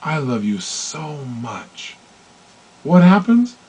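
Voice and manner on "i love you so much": slow, soft, tender I love you; hushed loving declaration; most loving tone. Acted. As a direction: melt it out lovingly. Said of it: "most loving tone of voice".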